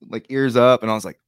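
ordinary talk

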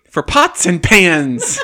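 slow words broken by real laughter; laughing. Real, not acted. To the table about the cheap sale, laughing slowly. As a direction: laugh out the words, stretched and loud. Player cracking up at the joke.

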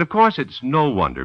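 normal talking voice

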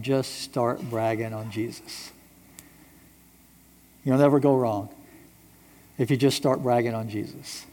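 softly restated. Real, not acted. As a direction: let the advice sink in gently. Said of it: "restates advice to congregation".

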